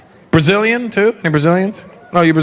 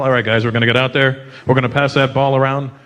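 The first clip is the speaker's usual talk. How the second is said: team captain pep talk; 7 semitones lower than usual. Retold or imitated. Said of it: "other countries in their huddle".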